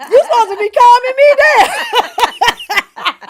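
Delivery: mock pleading with him; exasperated protest. Wife protesting to husband he should calm her. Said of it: "I be like".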